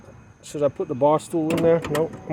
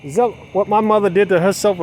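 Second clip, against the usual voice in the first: ordinary talk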